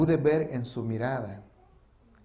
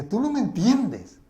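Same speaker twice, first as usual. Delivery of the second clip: louder, higher, drawn-out retort; strong aggressive voice; 7 semitones higher, 5 dB louder, slower than usual. Retold or imitated. Killer confronting the pastor who forgives him, forceful. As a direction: raise the voice forcefully on a slow accusation. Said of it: "con un tono de voz fuerte".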